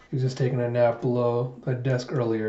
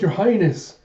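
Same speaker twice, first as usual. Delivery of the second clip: higher-pitched, mildly dramatised cry of alarm; retold exclamation, pitched up; alarmed call; 6 semitones higher, 4 dB louder than usual. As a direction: call out to royalty in mock alarm.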